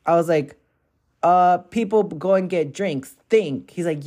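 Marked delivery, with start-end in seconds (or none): 1.2-3.7 higher, louder mocking retort; sarcastic echo of his Think, pitched up and louder; mocking snap back. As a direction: throw his own word back at him. She snaps back at guy.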